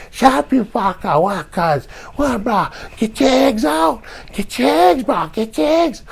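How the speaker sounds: squeaky taunting punk; imitating skinny loudmouth. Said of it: "the one that's talking the loudest".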